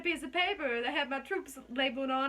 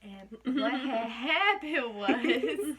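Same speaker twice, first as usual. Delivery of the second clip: laughing; 6 dB louder, much slower than usual. Real, not acted. Laughing while starting the story.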